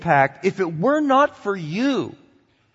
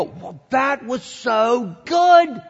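slow appreciative sigh; 4 semitones higher, much slower than usual. Retold or imitated. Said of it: "you go".

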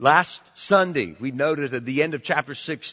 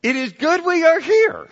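higher, louder, excited replay; excited Peter; 12 semitones higher, 7 dB louder than usual. Retold or imitated. Preacher trying Peter's words to the congregation, excited. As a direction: say it like a thrilled man. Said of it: "I don't know if he said that excitedly".

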